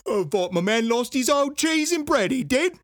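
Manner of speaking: high, loud hammed-up Cockney accent; mock Cockney. Host imitates the Cockney guard at the detention facility, hammed-up Cockney. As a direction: ham up a Cockney geezer, all rhyming slang. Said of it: "Cockney man".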